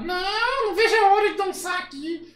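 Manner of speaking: very high, loud, gleeful puppet voice; Kleber. Acted. Puppet eager to dance here, high puppet voice. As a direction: squeal with delight as a dummy. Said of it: "acha uma voz que pareça com o boneco".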